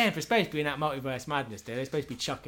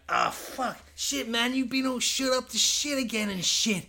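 much higher panicked soldier voice; hammed-up soldier; 11 semitones higher than usual. A soldier panicking about his shot mate, much higher. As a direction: panic over your wounded friend.

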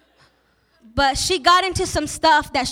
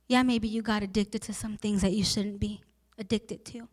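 much softer, gentle sermon voice; tender hushed preaching; 5 semitones lower, 6 dB quieter than usual. Acted. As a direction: lower the voice and draw them close.

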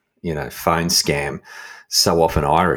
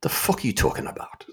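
baffled mutter; 7 semitones higher, 6 dB quieter than usual. Retold or imitated. Imagined baffled scammer.

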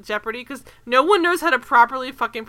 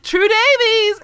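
higher, louder, slow call of "True Davies!"; calling out a name; 10 semitones higher, 8 dB louder, much slower than usual. Retold or imitated. Ceremony calling her name.

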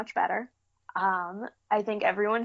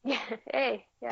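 mock Canadian; 4 semitones higher than usual. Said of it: "accents, eh?".

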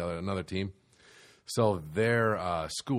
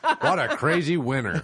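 high, raised mock stand-up patter; hammed-up clueless comic shouting; shouting out things; 8 semitones higher, 6 dB louder than usual. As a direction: holler it at an imaginary crowd. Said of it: "Just shouting out things".

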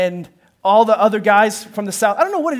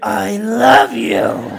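put-on very slow, overdone child declaration; hammed-up, stretched-out "I love you"; exaggeratedly meaning it; 5 dB louder, much slower than usual. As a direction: drag out each word in mock compliance. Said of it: "Say it like you mean it".

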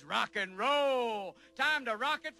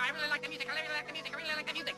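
high chipmunk voice; sped-up record voice; 8 semitones higher, 4 dB quieter, much faster than usual. Pretend. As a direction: squeak it like a record spun too fast. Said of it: "Let's get up on seventy-eight".

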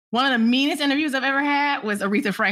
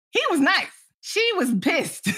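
ordinary delivery

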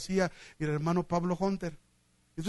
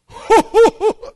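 a big booming burst of laughter, high; imitated loud laugh; 17 dB louder than usual. Old preacher laughing in the night at the hotel, booming laugh. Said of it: "empieza a oír una risa del hermano Fermín".

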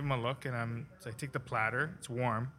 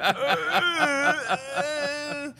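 high, whimpering stammer; frightened stammer; 9 dB louder, much slower than usual. Retold or imitated. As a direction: stammer like a scared kid. Quoting his own frightened stammer.

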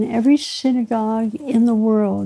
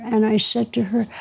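usual speaking voice

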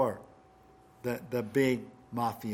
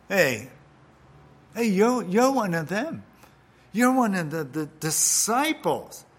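put-on accusing servant girl; 5 semitones higher, 7 dB louder than usual. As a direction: call Peter out in front of everyone.

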